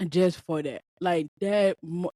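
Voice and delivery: ordinary talk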